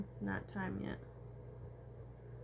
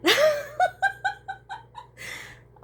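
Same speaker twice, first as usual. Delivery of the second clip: bursts out in a loud high laugh; a big loud cackle at the line; 8 dB louder than usual. Real, not acted. Laughing at the quote.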